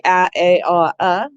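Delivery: "a, a, ah, uh" sounded out one by one; letter A sounds. Shown as a demonstration. Teacher modelling the sounds of A, sounded out. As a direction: try each sound of "a" in turn, ending on "uh". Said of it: "one of the sounds of the A".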